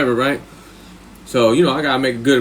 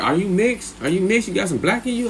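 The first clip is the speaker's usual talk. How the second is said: curious woman; 6 semitones higher, 3 dB quieter than usual. Retold or imitated. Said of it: "She's like".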